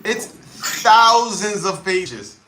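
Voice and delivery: THOUSANDS drawn out slowly, high and loud; exasperated emphasis. Real, not acted. Creator complaining about terms of service, THOUSANDS stressed in exasperation.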